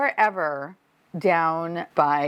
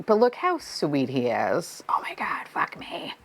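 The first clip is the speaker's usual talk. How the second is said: whisper; 4 semitones lower than usual. Real, not acted. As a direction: breathe it out in a whisper. Tender aside over the figure.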